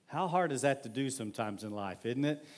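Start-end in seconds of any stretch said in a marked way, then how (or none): none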